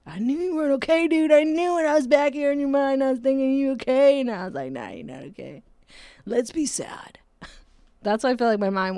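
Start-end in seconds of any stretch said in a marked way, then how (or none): 0.1-4.2 high, loud taunting inner voice; depression voice. Acted. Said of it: "That's my depression voice". 4.7-7.2 depression voice. Acted. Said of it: "That's my depression voice".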